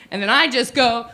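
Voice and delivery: usual speaking voice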